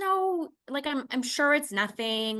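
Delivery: high, light brush-off of a worry; breezy dismissal. Retold or imitated. Patient brushing off her husband's offer, breezy.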